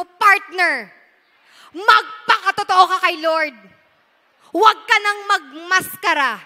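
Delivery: loud, urging call to a neighbour; preacher has the congregation urge their neighbour loudly; calling to the person beside you. Pretend. Tell your neighbour to be real.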